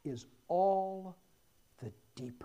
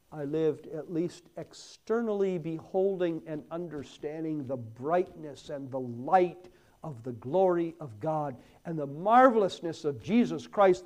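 ordinary talk

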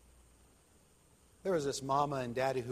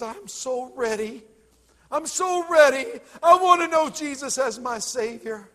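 fervent cry; 11 semitones higher, 17 dB louder than usual. A narrator's reading. Son ready to be saved.

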